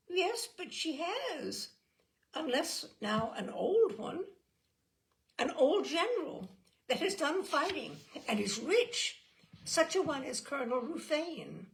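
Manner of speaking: a hint of a coy girl; lightly voiced young girl. A narrator's reading.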